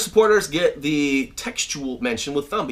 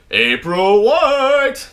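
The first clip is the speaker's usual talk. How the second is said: slow, drawn-out, much higher and louder; shout-out; 6 semitones higher, 7 dB louder, much slower than usual. Real, not acted. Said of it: "shout all these out".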